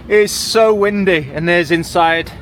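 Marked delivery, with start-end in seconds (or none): 0.1-1.3 raised voice over a gale, slowed; shouting over wind. Real, not acted. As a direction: call it out slowly over the wind. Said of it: "I don't know if you heard me just then".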